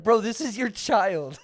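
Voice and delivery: slow, laughing through the words; laughing disbelief. Real, not acted. Laughing at a bad movie scene, telling a friend, with slow disbelief. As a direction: laugh as you point out the obvious.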